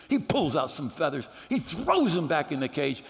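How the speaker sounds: usual speaking voice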